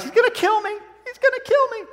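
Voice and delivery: very high squeaky mock panic; mock panicked thought. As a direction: imitate a terrified inner voice, way up high. Woman's panicked thought in retold story.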